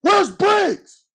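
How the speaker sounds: high, drawn-out call across the field; mock yell from the sideline; coach yelling out. Coach calling for a missing rookie, mock yell. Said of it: "somebody yelling out".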